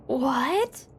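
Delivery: ordinary talk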